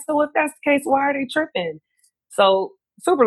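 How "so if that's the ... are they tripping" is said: exasperated. Retold or imitated. Retorting to airport lady, exasperated.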